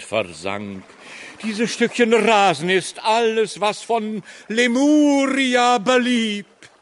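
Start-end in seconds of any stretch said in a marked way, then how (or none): 1.4-6.7 hammy stage actor. Acted. Actor laments lost Lemuria.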